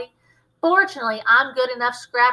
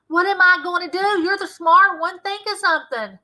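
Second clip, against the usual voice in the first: higher, louder desperate plea; panicked pleading; 8 semitones higher, 6 dB louder than usual. Acted. Scared boy begs Mo for an idea. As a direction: beg for help in a panic.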